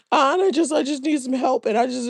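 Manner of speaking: high, whiny mock plea; hammed-up soft begging voice; mocking soft fake emotion. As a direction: play him as a fake-needy man. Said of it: "he's being all soft and fake emotional".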